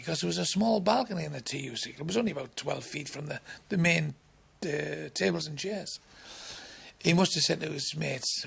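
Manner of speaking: ordinary talk, nothing marked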